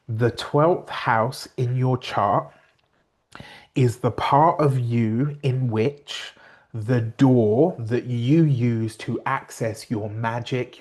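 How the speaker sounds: slow, deliberate explanation with long pauses; measured, careful pace, phrase by phrase; really slow. Real, not acted. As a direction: spell it out slowly so nobody gets confused. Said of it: "I have to say this really slowly".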